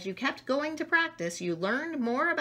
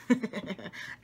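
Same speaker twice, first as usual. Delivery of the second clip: laughing; 4 dB quieter than usual. Shown as a demonstration. Laugh after naming emotions.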